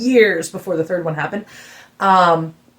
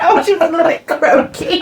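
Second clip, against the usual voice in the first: high, amused repeat through laughter; laughing echo; 9 semitones higher than usual. Real, not acted. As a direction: tease it while laughing. Teasing echo of the singer.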